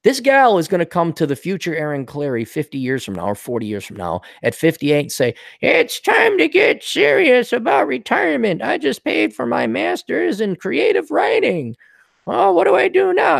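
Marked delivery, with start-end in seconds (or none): 5.6-13.4 whiny woman voice. Retold or imitated. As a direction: whine like a spoiled grad. Mocking a future indebted woman.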